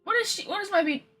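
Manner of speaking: ordinary delivery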